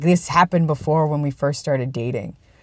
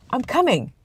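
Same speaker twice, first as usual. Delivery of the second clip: high-pitched call with a falling end; quick sing-out reply, pitched up then dropping; calling back; 7 semitones higher than usual. Real, not acted. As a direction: answer someone who is waiting for you. Answers someone calling him, off camera.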